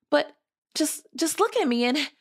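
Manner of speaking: high, hesitant objection; flustered protest. A narrator's reading. Cinderella protests to the fairy.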